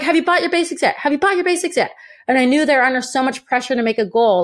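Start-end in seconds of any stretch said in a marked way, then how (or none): 0.0-1.9 high, robotic repeated sales pitch; glazed recruiters. Retold or imitated. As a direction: chant it like a brainwashed seller. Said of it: "They're like".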